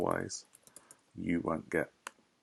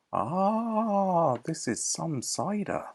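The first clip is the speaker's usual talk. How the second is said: higher, slow relish; savoring cider; 10 semitones higher, much slower than usual. Acted. Animal relishing the cider, higher and slow. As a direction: sigh with pleasure over the drink. Quoting Badger in quiz.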